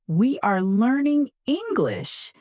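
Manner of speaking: high-pitched, cheerful model sentence; happy. Shown as a demonstration. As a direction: say it high with a big smile. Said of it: "but try to sound happy".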